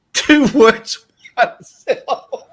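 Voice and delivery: laughing. Real, not acted. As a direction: repeat it giggling. Laughing repeat of clue.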